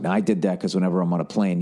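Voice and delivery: normal talking voice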